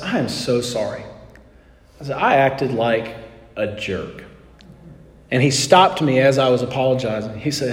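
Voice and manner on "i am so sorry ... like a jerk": soft, low, slowing apology; quiet apology. Retold or imitated. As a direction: say sorry softly, dropping lower to the end. Apologizing to the counter guy.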